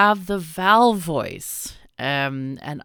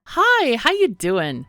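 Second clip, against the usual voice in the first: bright high hello to the robot; excited greeting; 7 semitones higher, 7 dB louder, slower than usual. Real, not acted. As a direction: greet it cheerfully.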